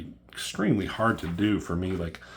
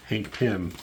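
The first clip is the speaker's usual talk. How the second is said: ordinary talk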